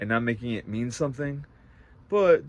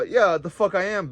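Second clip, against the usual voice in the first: louder, higher defiant retort; 7 semitones higher, 4 dB louder than usual. Retold or imitated. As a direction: spit back yeah, the fuck I am. Defiant reply to snitching question.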